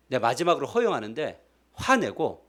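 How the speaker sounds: ordinary delivery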